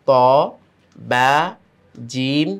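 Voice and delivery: ordinary delivery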